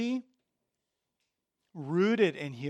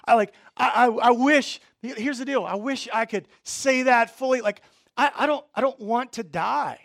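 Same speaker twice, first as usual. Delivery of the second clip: raised, urgent pulpit voice; impassioned preaching; 6 semitones higher, 9 dB louder than usual. Real, not acted. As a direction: press the point on the congregation.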